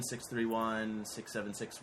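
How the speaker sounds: ordinary delivery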